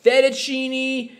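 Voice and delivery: loud, high imitation of an exasperated dad; ranting father. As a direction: draw out each food name in mock outrage. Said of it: "was he said".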